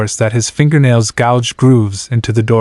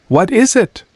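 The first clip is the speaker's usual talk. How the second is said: high, confused question; ask, baffled, high; 10 semitones higher than usual. Acted. Mark confused.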